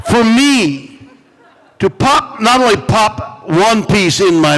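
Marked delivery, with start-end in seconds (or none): none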